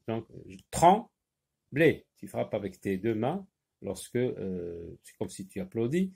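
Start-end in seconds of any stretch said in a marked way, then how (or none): 0.7-2.1 "trembler" split as trem... bler; two measured beats on "trembler"; syllable by syllable. Shown as a demonstration. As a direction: read "trembler" as if clapping each syllable. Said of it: "en frappant les syllabes".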